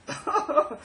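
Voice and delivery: high, bright burst of real laughter. Real, not acted. Mocking the creed, he bursts out laughing. Amused at own joke about Jesus.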